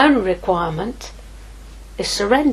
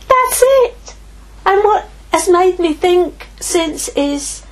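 fervent preaching; 6 semitones higher than usual. Acted. Preacher urging the church toward wonder, impassioned. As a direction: preach it with building fire.

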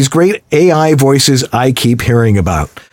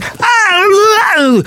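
mock angry yell. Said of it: "I see you going".

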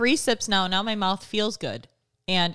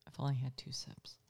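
whispering; 7 semitones lower, 15 dB quieter than usual. Real, not acted. Tipsy aside to cohost.

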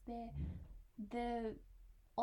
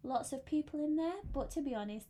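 ordinary talk

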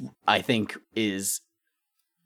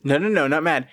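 loud, higher mock correction; mock insistence; 6 semitones higher, 6 dB louder than usual. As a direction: insist loudly, half joking. Joking correction of the word.

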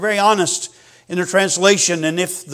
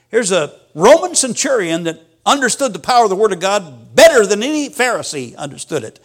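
normal talking voice

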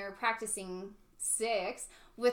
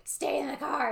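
a touch of stern mother giving an order; mock strict mother, firm and short; scary mom voice; 5 semitones higher, 3 dB louder than usual. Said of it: "the scary mom voice".

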